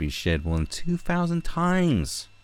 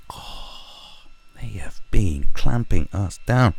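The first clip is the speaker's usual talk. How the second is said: whisper; 2 semitones higher, 8 dB louder, much slower than usual. Acted. Paranoid conspiracy rant.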